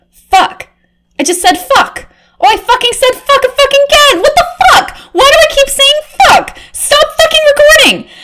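high, loud, fast cursing outburst; panicked shrill swearing. Acted. Reporter horrified she keeps cursing on tape, shrill panic. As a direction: swear in a shrill panic you can't stop. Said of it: "Why am I talking like this".

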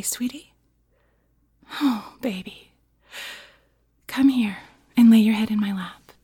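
hushed, indulgent pillow talk; doting mommy roleplay. Pretend. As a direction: coo tenderly, with long pauses.

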